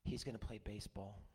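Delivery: put-on low, soft, subdued child's answer; retold sadness. Granddaughter answering grandpa about her friend Logan, gently sad. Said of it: "she said to me sadly".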